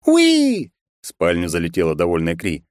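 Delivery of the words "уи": high delighted squeal; gleeful squeal. Acted. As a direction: squeal with joy. Kri bursts in gleefully.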